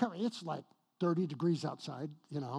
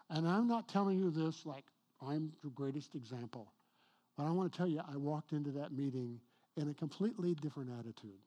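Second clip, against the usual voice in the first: ordinary talk, nothing marked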